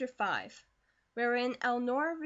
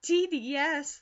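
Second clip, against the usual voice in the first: laughing; 5 semitones higher, 7 dB louder than usual. A narrator's reading. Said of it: "laughed Elnora".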